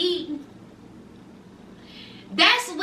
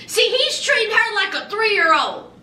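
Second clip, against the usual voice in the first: usual speaking voice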